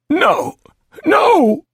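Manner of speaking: high, loud sobbed refusal; tearful cries, pitched very high; crying. Acted. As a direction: wail it desperately. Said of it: "He began to cry".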